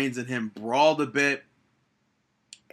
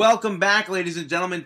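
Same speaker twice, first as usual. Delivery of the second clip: big higher, louder show-host welcome; 5 semitones higher, 5 dB louder, faster than usual. Acted. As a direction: open the segment like a broadcaster.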